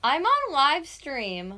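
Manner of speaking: slow, high, loud warning; yelling. Real, not acted. Warning sleepy boyfriend she is live, yelling. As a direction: call it out, stretching the words. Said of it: "I normally yell like this".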